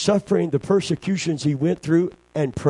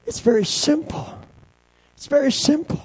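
much higher third time, very stressed; rising emphasis; 13 semitones higher than usual. Shown as a demonstration. Preacher repeating the simple answer, rising. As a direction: push it up each repeat. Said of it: "repeated three times rising".

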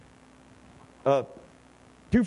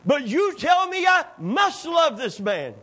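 higher, louder shout on "I must love this man"; shouted prayer; 8 semitones higher, 4 dB louder than usual. Retold or imitated. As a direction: cry it out to the whole church. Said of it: "Corrie was right".

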